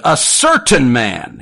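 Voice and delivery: loud, drawn-out Texan preaching impression; imitated Texan sermon, hammering out the phrase; Texan preacher accent. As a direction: pitch it high and slow, like a Texan Baptist preacher. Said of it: "in his very thick, uh, Texan accent".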